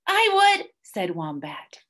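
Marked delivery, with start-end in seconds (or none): 0.1-0.7 very high, keen volunteer; hopeful little squeak. A narrator's reading. Wombat eagerly volunteers.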